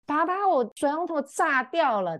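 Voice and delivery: mock a kid shouting down from upstairs in alarm; child yelling from upstairs. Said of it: "他的小孩就在楼上大喊说". (模仿小孩在楼上高声大喊)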